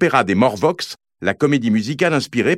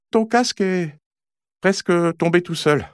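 ordinary delivery

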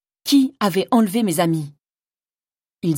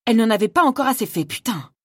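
furious outburst; 5 semitones higher than usual. Acted. Rena erupts at the queen's cruel note, angry and fast. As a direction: spit it out in rage.

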